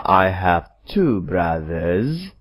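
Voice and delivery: slow, careful teacher's example; slow modelling voice; slow model reading. Shown as a demonstration. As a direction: read it slowly so students follow. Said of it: "Tetapi pada nomor lima".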